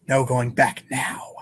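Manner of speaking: ordinary talk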